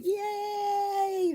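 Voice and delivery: high, joyful shout of yay; squealing happy yay; excited cheer. Real, not acted. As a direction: cheer out with delight. Grandkids coming, cheers.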